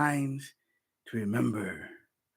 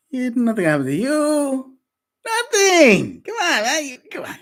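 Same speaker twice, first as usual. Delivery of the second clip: mock scoff; 14 semitones higher, 9 dB louder, slower than usual. Mocking the serpent's lie.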